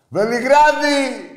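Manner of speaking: high, drawn-out bellow; drawn-out shout. Real, not acted. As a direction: belt out the city name, stretching it. Host calls out the match city.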